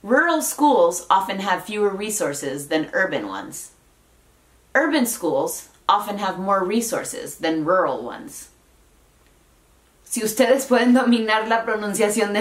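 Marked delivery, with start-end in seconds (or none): 0.0-8.5 slow, careful American English, "rural" as RUR-ul; unhurried teaching read, "rural" fully sounded; model sentence. Shown as a demonstration. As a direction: articulate "rural" and "urban" with clear American Rs. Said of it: "En un enunciado".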